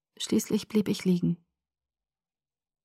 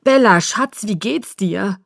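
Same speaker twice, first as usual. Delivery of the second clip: distorted with worry; 2 semitones higher, 7 dB louder, slower than usual. A narrator's reading. Father to his found daughter, distorted with worry. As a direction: worry pushing the voice up at first. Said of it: "vor Sorge verzerrt".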